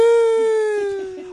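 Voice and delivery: high falsetto shout. Acted. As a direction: belt out a shrill shoo. Jingle-style shout between segments.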